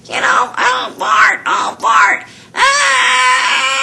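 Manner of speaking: high, loud raspy Marge Simpson cry; Marge Simpson impression. Shown as a demonstration. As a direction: wail Oh Bart like Marge. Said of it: "this Marge Simpson thing".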